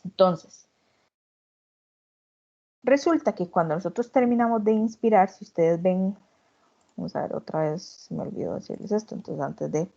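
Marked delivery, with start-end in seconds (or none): none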